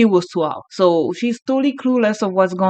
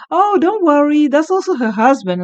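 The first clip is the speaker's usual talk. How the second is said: lightly voiced, higher and breezy; casual friend; 7 semitones higher, 4 dB louder than usual. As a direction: wave it off casually. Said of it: "It's like".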